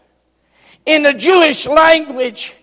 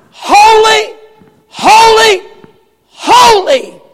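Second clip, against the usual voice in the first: higher, louder cry of holy; proclaimed holy; 7 semitones higher, 5 dB louder, slower than usual. Shown as a demonstration. Preacher showing the third degree, loud cry. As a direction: ring it out three times. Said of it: "in the third degree".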